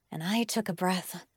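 ordinary delivery

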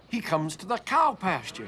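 plain reading voice

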